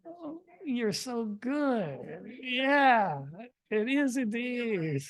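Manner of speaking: high, drawn-out delighted exclamation; delighted praise. Real, not acted. Reader praising audience who know the word, real delight.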